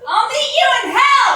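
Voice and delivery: shouted dramatic farewell; loud shout. Acted. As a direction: yell it across the stage. Sketch villain farewell, very loud.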